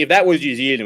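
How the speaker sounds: usual speaking voice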